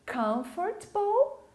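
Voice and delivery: "Comfortable" as KUMF-ert-bul, slow and high; correct pronunciation. Shown as a demonstration. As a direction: model "Comfortable" with first-syllable stress and a short -bul ending. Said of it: "Ela é diferente".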